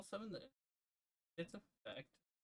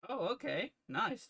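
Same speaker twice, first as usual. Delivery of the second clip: high, loud delighted surprise; surprised; 10 semitones higher, 6 dB louder than usual. Real, not acted. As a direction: react with a pleased jump up in pitch. Opponent's surprising play succeeds.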